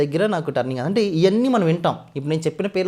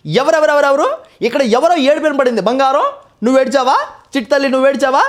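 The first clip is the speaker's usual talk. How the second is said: much higher, louder comic film voice; Saptagiri voice impression; 13 semitones higher, 9 dB louder than usual. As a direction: crank it way up high and loud, playing the comedian in a ghost scene. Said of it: "ఈ రెండు modulation మాట్లాడితే ఎలా ఉంటుంది అనేది మీకోసం సరదా చేసి చూపిస్తాను".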